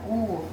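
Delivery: U said alone as its own syllable; syllable separated. Shown as a demonstration. Teacher splits the word for pupils, u standing alone. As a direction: set the u apart.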